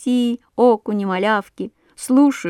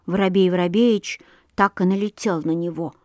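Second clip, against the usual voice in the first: usual speaking voice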